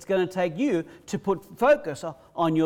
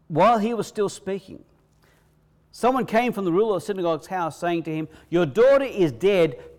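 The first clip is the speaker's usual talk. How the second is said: raised, louder reading of the gospel, building as it goes; scripture reading; 5 semitones higher, 6 dB louder than usual. Acted. Preacher reading the gospel to the congregation, ringing. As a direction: proclaim the verses to the congregation.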